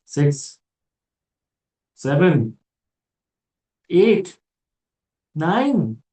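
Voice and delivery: exaggerated count, each number pitched high and loud; mock amazement. Host tallying an influencer's many jobs, mock amazement. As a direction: count up in escalating disbelief.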